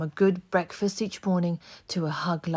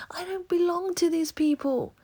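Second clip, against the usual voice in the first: put-on boy shouting; 13 semitones higher than usual. A narrator's reading. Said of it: "shouted Oliver".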